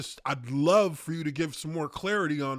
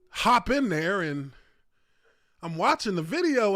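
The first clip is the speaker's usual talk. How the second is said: ordinary delivery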